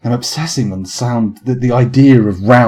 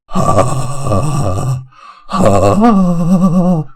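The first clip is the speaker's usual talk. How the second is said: long quavering "ah", the second higher; unmusical wobbling "ah"; 6 semitones higher than usual. Shown as a demonstration. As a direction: hold a wobbly, tuneless note. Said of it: "this isn't musical though".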